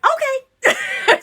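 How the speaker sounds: very high, chirpy agreement; high-pitched mock compliance; breezy okay. Acting her breezy agreement to husband.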